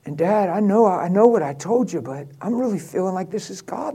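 normal talking voice

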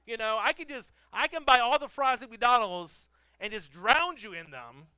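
mock furious parent lecture; mock angry dad. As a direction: playfully threaten the ungrateful kid. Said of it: "And I was like".

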